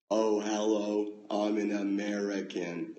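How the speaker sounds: slow, overstretched American vowels; Brit's overdone American accent, loud opening; British-doing-American accent. Shown as a demonstration. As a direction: open the throat and drag out a fake American accent. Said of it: "you're trying to stretch out the vowels so much".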